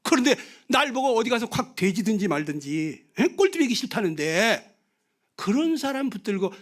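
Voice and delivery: ordinary talk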